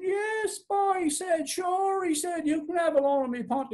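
high, lilting, agreeable voice; easygoing fellow. A narrator's reading. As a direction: agree readily in a high, easy lilt. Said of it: "being an easygoing sort of fellow".

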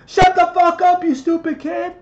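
put-on high, loud screeching yell at a kid; abusive parent yelling. Mocking an abusive parent screaming at their child, shrill loud yell.

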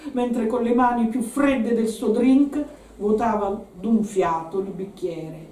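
ordinary delivery, nothing marked

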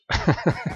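short laugh at oneself; quick giggling laugh; amused chuckle. Real, not acted. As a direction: chuckle at your own clumsiness. Laughs at his own overshoot.

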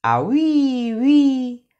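high whistling wind uí; a howling gust of wind. Acted. The wind whistles through the door crack, high howl.

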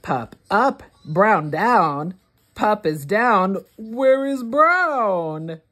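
plain reading voice

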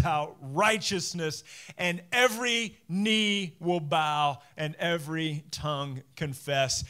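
slow, weighty reading of God's words; solemn scripture reading. Acted. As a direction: read it as a solemn declaration.

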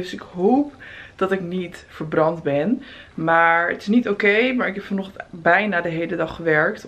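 ordinary talk

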